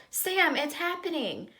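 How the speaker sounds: a teacher's alarmed shout across the stage; yelled. A narrator's reading. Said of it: "Mister Nakobe yelled".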